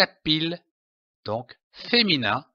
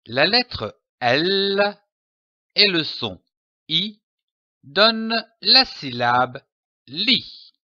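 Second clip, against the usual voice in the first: teacher building a syllable step by step; slow reading lesson voice; 4 semitones higher than usual. Shown as a demonstration. Teacher showing a child how letters make a syllable, slow and careful. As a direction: spell it out slowly for a child. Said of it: "Je lis une syllabe".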